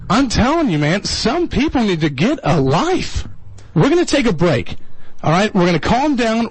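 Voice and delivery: ordinary delivery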